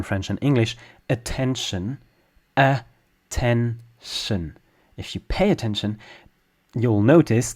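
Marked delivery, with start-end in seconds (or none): 1.1-2.0 attention said uh-TEN-shun; English pronunciation. Shown as a demonstration. Teacher introduces the example word, English pronunciation of attention. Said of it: "the same in English". 2.6-3.7 attention slowly as uh... TEN... shun; syllable by syllable. Shown as a demonstration. Teacher breaks down the word for learners, syllable by syllable. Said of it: "pronounced the same in English".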